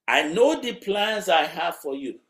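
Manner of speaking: high, loud proclamation as God; God speaking. Acted. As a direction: declare it firmly in God's voice. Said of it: "That is God talking".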